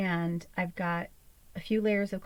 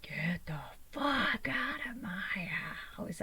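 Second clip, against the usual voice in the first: slow hissed whisper of rage; whispered fury; 2 semitones higher, 5 dB quieter, much slower than usual. Retold or imitated. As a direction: seethe it under your breath. Whispered mock rage at cat.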